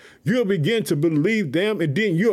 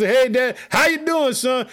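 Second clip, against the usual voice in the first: happy reply; 4 semitones higher than usual. Pretend. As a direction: answer your dad with joy. The son's imagined reply to his father.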